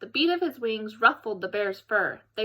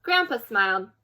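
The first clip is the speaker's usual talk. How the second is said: ordinary delivery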